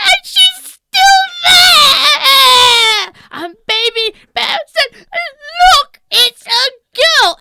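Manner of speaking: very high loud squealing cry; very high, loud alarmed squeal; shocked baby bear. Acted. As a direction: shriek the discovery. Baby Bear finds Goldilocks.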